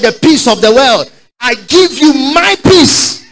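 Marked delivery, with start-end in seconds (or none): 1.4-3.2 high, loud and slow, stretched out; ringing proclamation. Real, not acted. Preacher proclaiming peace to the congregation, high and slow. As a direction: proclaim it from the pulpit.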